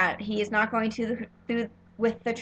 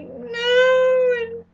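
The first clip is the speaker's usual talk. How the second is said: high loud drawn-out cry of no; anguished no; 13 semitones higher, 6 dB louder than usual. Retold or imitated. Fan reacting to the character's fate, wailing a long high no. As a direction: wail it like heartbreak. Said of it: "And I was like".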